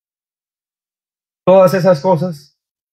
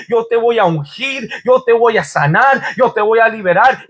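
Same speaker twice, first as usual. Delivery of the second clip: higher, building preacher's promise; impassioned preaching; 4 semitones higher than usual. Acted. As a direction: preach it with rising fervor. Preaching God's promise at full force.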